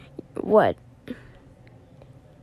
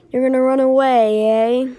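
taunting; 2 semitones higher, 5 dB louder than usual. Acted. Villain taunts.

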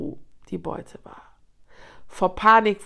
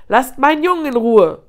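loud, raised, furious order; raging; 5 semitones higher, 11 dB louder than usual. A narrator's reading. Lioness mother defending her son from hyenas, raging shout. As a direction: roar it protectively. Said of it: "tobte Salama".

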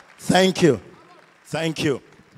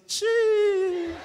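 long, very high, loud squealed syllable; demonstrated high-pitched exclamation; 5 dB louder than usual. Shown as a demonstration. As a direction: let out a sustained piercing cry.

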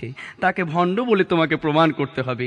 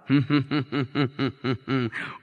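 scheming chuckle; 3 semitones lower, 5 dB quieter than usual. Acted. Zamindar plots a scheme.

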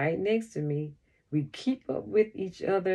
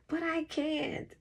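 distressed; 12 semitones higher, 4 dB quieter than usual. A narrator's reading. Crying child cannot stay still.

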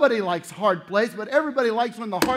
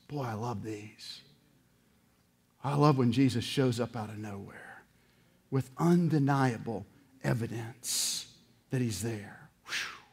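hushed delight; 9 semitones lower, 8 dB quieter than usual. Real, not acted. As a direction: savour it almost under the breath.

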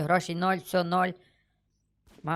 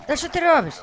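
high, loud, startled question; alarmed protest; 11 semitones higher, 5 dB louder than usual. Real, not acted. Player objecting to what another character does, alarmed.

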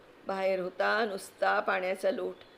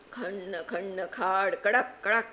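slow, rhythmic imitated clanging; mimicking blows on an iron lock; hammer sounds; slower than usual.

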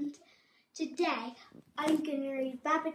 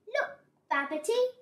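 high, loud mouse call; 10 semitones higher, 5 dB louder than usual. A narrator's reading. Mouse calls Babbitty at the hilltop, calling out. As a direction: call for a friend's attention. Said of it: "called Mouse".

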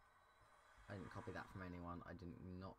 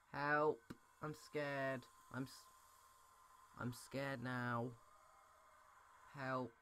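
louder, higher frightened cries for help; panicked cry; 5 semitones higher, 4 dB louder, slower than usual. Real, not acted. Gamer hiding from the monster, crying for help. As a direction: call out as if the monster is near.

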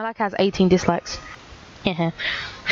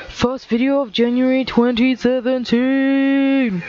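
mock grand flourish; 4 semitones higher, slower than usual. Vlog outro flourish.

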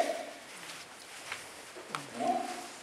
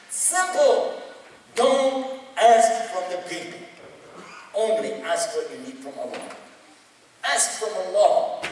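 emphatic preaching; 6 semitones higher, 3 dB louder than usual. Acted. Preaching at full force.

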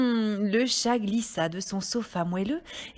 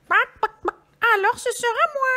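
high, loud, drawn-out hen; little hen voice; 14 semitones higher, 7 dB louder, slower than usual. A narrator's reading. Hen deciding to do it herself, high hen voice. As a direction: squawk it slowly like a hen. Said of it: "dit la petite poule rousse".

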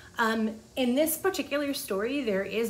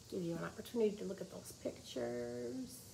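soft aside; 10 dB quieter than usual. Real, not acted. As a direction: murmur while showing the pictures. Quiet aside while showing pictures.

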